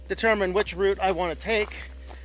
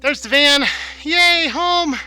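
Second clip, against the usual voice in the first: excited; 9 semitones higher, 5 dB louder, slower than usual. Real, not acted. Relief at reaching the van.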